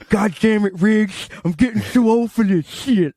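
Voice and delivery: lightly voiced weary cop; Lethal Weapon quote. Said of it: "the dude from Lethal Weapon".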